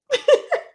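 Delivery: short squeal of laughter; real laugh at his face; high laugh burst. Real, not acted. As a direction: let a high laugh escape. Laughing at Marceau.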